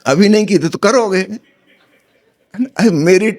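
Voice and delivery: acted exasperation for a joke; mock indignant retort. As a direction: argue back with playful indignation. Joke punchline, Nasruddin's indignant retort.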